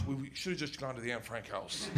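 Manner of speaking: low, hushed aside; muted deadpan remark to a friend. Retold or imitated. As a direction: lean in and say it softly, low. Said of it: "I lean over to my friends".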